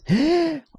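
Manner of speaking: a sudden high gasp of shock; sharp gasp. Real, not acted. Gamer caught off guard, sharp high gasp. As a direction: suck in air, startled.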